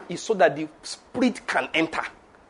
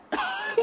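high, soft mock laugh; imitated mocking laugh; 9 dB quieter than usual. Preacher acting the board laughing at him, high mocking laugh. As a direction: imitate people laughing at a newcomer. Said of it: "They start laughing".

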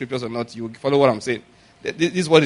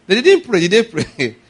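high, loud declaration; preacher calls out loud; preaching shout; 6 semitones higher, 6 dB louder than usual. Real, not acted. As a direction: proclaim it to the hall. Preaching rising to a peak.

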